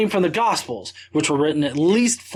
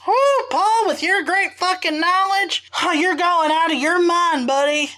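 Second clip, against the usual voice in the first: put-on southern accent; 11 semitones higher than usual. Mocking Festus scolding Paul, high hammed-up southern accent. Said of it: "Festus would definitely sound like this".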